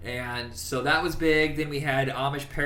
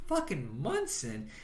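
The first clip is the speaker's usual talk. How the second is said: softer, slow mocking mutter; disgusted mutter; 7 dB quieter, slower than usual. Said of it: "he's like".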